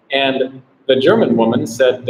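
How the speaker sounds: normal talking voice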